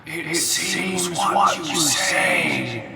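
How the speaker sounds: slow echoing sinister threat; menacing spirit. Acted. Will-O-Wisp threatens Frostbite, slow and menacing. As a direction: toy with him before striking.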